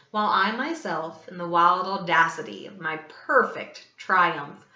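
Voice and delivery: ordinary delivery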